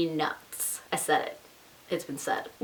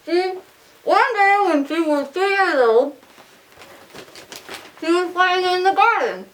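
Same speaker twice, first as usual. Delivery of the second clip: cheeks stuffed full; 7 semitones higher than usual. Real, not acted. Reading Peter Pan, cheeks stuffed.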